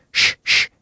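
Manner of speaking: sh sh held as a hushing hiss; sh sound. Shown as a demonstration. Teacher isolating the sound for students, long hushing sh. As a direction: hush out sh, sh slowly. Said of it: "Also the S H".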